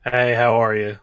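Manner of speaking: unenthused, level greeting; very unexcited. Real, not acted. Guest greeting the podcast host, very unexcited. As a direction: answer as if not excited to be here. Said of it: "You sound very not excited to be here".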